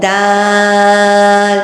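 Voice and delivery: دال held very long; drawn out. Shown as a demonstration. Teacher showing the long vowel. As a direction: stretch دال out. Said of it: "کھینچ کے پڑھیں گے".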